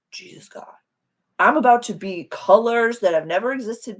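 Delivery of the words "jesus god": soft whispered amazement; awed whisper. Pretend. Imagined first flower whispers in wonder. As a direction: whisper like seeing colors for the first time. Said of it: "might have been a little bit like".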